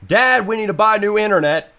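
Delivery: calling out to dad about the internet. Real, not acted. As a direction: shout it to someone in another room.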